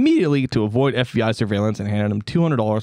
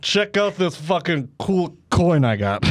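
put-on slow, higher drunk bragging; boastful drunk spy; 5 semitones higher, slower than usual. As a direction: show off the coin like a proud drunk. Spy shows off hollow coin.

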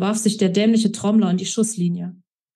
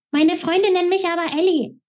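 much higher mocking echo of her words; mocking mimicry; 10 semitones higher than usual. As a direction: ape her in a squeaky voice. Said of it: "äffte ich sie in schriller Tonlage nach".

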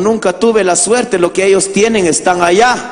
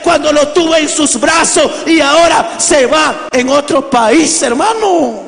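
raised, higher preaching voice; impassioned preaching; 8 semitones higher than usual. Acted. Preacher tells the congregation of migrant sons, impassioned preaching.

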